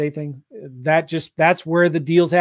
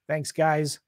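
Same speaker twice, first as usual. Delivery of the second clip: normal talking voice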